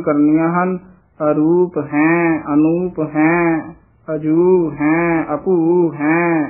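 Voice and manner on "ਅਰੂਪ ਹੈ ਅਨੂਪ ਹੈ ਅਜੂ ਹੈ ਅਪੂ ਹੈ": each ਹੈ slow and nasalised, hain; nasalised endings. Shown as a demonstration. Teacher models for reciters, slow nasal ਹੈ. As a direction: draw out ਹੈ through the nose. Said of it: "ਇਹਨਾਂ ਤੇ ਬਿੰਦੀਆਂ ਲੱਗੀਆਂ ਹੋਈਆਂ".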